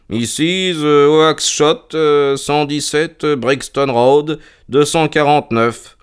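slow, halting read-aloud; reading out an entry. Acted. Reads the ledger aloud on demand.

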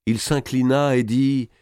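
ordinary delivery

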